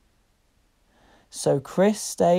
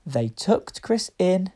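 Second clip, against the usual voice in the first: ordinary delivery, nothing marked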